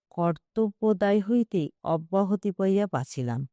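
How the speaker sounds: usual speaking voice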